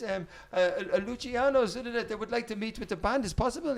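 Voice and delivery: high, polite, flustered messenger; high-pitched halting request; put-on foreign woman. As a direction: imitate an apologetic woman visitor. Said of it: "This woman".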